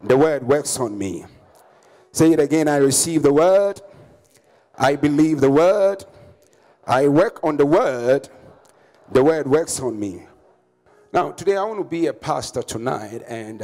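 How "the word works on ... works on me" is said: loud, emphatic preacher's declaration; congregational declaration. Real, not acted. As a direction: proclaim it firmly for the congregation to repeat.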